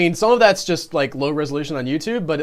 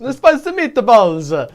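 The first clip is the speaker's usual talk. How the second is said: slow, louder hammed-up Italian accent; higher, drawn-out cartoon Italian; mock Italian accent; 5 semitones higher, 5 dB louder, slower than usual. Shown as a demonstration. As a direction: ham it up as a stage Italian, stretching the words. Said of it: "words that you can say to get into an accent".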